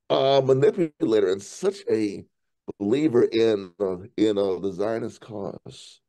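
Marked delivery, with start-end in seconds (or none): none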